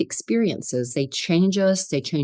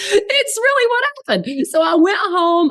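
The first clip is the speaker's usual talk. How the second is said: laughing; 5 dB louder than usual. Real, not acted. Laughing while retelling.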